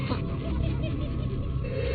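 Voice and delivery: a long, very high cackle that climbs; shrieking cackle. Acted. Joker gloating to Batman, shrieking with laughter. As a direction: shriek with delight at his pain.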